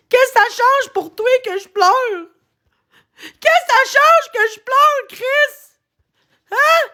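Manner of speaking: high, tearful, breaking voice; crying. Real, not acted. Streamer crying to Chris about being sad.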